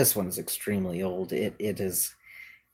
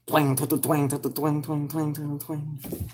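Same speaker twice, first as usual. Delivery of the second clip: imitating jaw harp twang; 5 semitones higher, 4 dB quieter, slower than usual. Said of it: "It's like".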